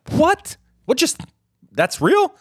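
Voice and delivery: high startled double take; shocked disbelief. Retold or imitated. Imitating people hearing of the attack, high and startled. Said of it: "It was like".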